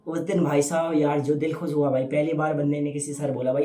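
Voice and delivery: usual speaking voice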